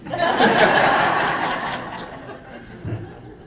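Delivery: high, breathy burst of laughter; big real laugh. Real, not acted. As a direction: let out a real belly laugh. Laughing at waitress punchline.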